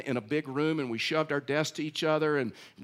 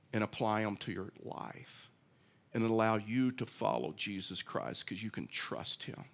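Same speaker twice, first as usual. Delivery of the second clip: softer, lower, tender plea; gentle pleading; 4 semitones lower, 5 dB quieter than usual. Acted. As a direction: speak as a humble pastor to his flock. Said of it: "here's what Paul said".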